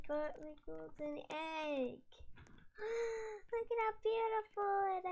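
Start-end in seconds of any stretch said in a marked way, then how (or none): 2.0-3.5 high, breathy gasp of amazement; high gasp of wonder; excited gasp. Real, not acted. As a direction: gasp at how beautiful it is. Gasps at the golden egg.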